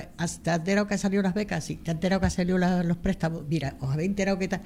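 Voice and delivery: fast, eager chatter; excited gossip. Retold or imitated. Coworkers rushing in with news about grants, fast and eager. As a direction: rattle it off quickly like breaking news.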